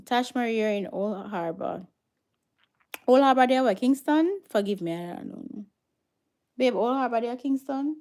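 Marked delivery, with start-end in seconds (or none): 3.1-4.4 loud, high puzzled question; loud confused query to viewers; calling out. Real, not acted. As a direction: call out the question to the chat.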